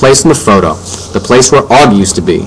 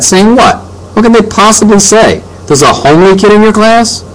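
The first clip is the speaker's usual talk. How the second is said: much higher, scoffing questions; incredulous; 12 semitones higher than usual. A narrator's reading. Dad to mom about the school letter, incredulous. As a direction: push the pitch up in disbelief.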